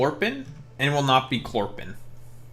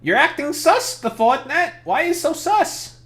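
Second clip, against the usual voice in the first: high-pitched squeaky voice all through; Bailey impression; 13 semitones higher than usual. Streamer mocking Ford as Bailey, high squeaky voice. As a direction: crank the pitch way up to mock Bailey. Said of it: "I have to do my best Bailey impression".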